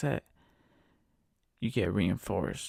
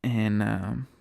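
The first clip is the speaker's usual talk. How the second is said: ordinary talk, nothing marked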